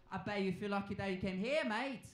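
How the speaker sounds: normal talking voice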